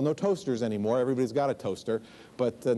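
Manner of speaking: ordinary talk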